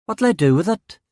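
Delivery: put-on a local boy, a touch unsure; a kid asking the detective what to do; young lad.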